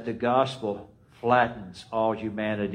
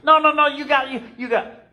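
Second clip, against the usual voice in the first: high excited protest; 6 dB louder, faster than usual. Acted. Arguing at the door.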